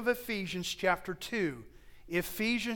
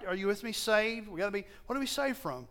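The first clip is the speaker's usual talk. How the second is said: ordinary talk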